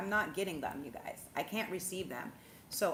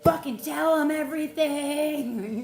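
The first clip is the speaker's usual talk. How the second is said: higher, louder, slowing into a booming goddess command; channeled goddess voice; 6 semitones higher, 8 dB louder than usual. Pretend. To her viewers, voicing the goddess energy she wakes up with, booming. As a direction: let the ancient goddesses speak through you, building to a roar. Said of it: "their energy is channeling through me to be like".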